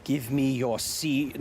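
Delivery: slow, drawn-out creepy demand; suggestive put-on voice. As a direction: draw out each word with mock menace. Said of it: "Don't say it like that".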